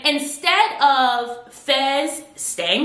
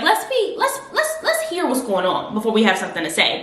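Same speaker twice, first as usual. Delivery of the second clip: usual speaking voice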